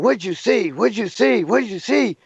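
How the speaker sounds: a palm reader demanding what he saw; urgent demanding girlfriend. Retold or imitated. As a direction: press him for answers like she's shaking him.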